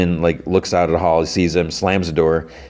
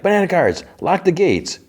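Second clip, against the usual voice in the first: high-pitched mock command; imitated princess summoning guards; acted royal order; 12 semitones higher than usual.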